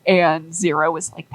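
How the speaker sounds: ordinary delivery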